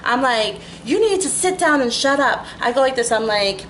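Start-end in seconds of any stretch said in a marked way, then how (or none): 0.8-2.4 mild retold yell back, a touch higher. Narrator retelling her comeback to the passenger, mild mock yell. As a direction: snap back at him, half-raised. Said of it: "I yelled back".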